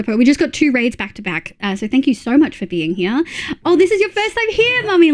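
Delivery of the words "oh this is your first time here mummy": excited. Real, not acted. Excited greeting of new viewer.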